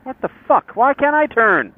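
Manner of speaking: high-pitched exasperated complaint; indignant road-rage cry; real frustrated outburst. Real, not acted. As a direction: vent at traffic, voice shooting up. Real outburst while driving.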